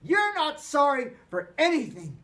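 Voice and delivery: loud, high angry shout; a boy shouting his rage. A narrator's reading. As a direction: hurl it back at him furiously. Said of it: "Peter shouted".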